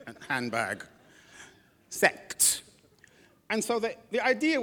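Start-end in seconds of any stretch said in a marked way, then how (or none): 1.9-2.6 Sect said unvoiced and crisp, ending on a hard t; repeated word. Shown as a demonstration. Preacher restating the word sect so it is not heard as sex. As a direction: breathe Sect out with a sharp t. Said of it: "Not sex, sect".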